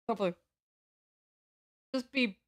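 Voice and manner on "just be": usual speaking voice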